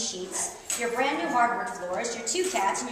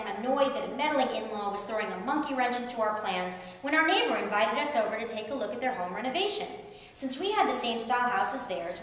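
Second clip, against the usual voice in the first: ordinary delivery, nothing marked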